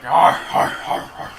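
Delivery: loud mock dwarf grunts, fading to breath. Friend mimicking the dwarf voice, loud hammed-up grunts. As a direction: copy the dwarf growl, hamming it up. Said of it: "You're like".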